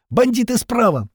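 high, sharp warning cry; alarmed shout. A narrator's reading. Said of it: "прокричала Лисичка".

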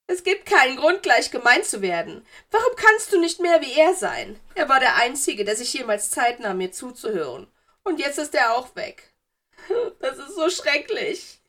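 high, loud, tearful reproach; weinerliches Geistermädchen. A narrator's reading. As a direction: wail accusingly as a hurt ghost girl. Narrator voices tearful ghost girl. (hoch, laut und weinerlich anklagend)

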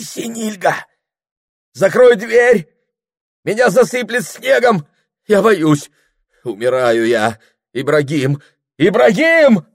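very high, loud panicked cries; terrified crying out. A narrator's reading. Freezing Прохор calling Ибрагим, terrified cries. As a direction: scream for help from a nightmare. Terrified in nightmare, calls for help.

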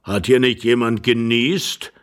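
put-on slow, drawn-out old husband's question; ponderous elderly man, taking his time; sluggish old man. A narrator's reading. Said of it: "fragte wieder der Greis in seiner trägen Langsamkeit".

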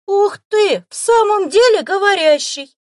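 very high, very loud shriek of joy; delighted squeal. Acted. Boy finally believes the fox talks, squealing. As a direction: squeal like a thrilled kid. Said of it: "восхищённо взвизгнул".

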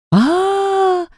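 high sudden scream. A narrator's reading. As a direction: shriek right in her ear. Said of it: "завопили над ухом".

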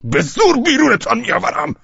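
high-pitched yelled threat; furious shout. A narrator's reading. Velchaninov threatening to drag him out, shouting. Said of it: "ولچانینوف فریاد برآورد".

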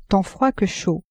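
ordinary delivery

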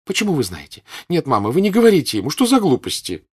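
fast, high and loud, defending what is hers; possessive protest. Acted. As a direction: daughter guarding what her mother would take away. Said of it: "тоном человека, у которого хотят отнять его собственность".

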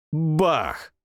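plain reading voice

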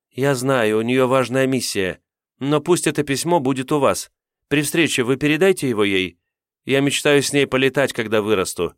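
put-on a hopeful teenager asking shyly; eager teen girl voice. Tavra's eager plea.

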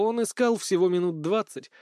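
ordinary delivery

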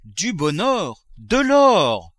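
gold fever bursting out; greedy exclamation. Acted. Miser seizing the gold, exclaiming greedily. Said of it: "s'écria-t-il".

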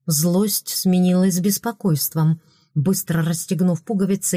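usual speaking voice

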